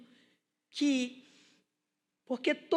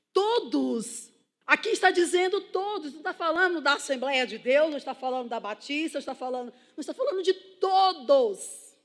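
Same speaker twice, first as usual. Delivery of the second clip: loud, high-pitched emphasis on "todos"; insistent pulpit delivery; forceful preaching; 4 semitones higher, 8 dB louder than usual. Real, not acted. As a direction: drive the point home to the congregation.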